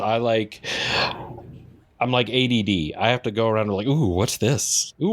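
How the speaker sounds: usual speaking voice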